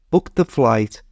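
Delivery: ordinary delivery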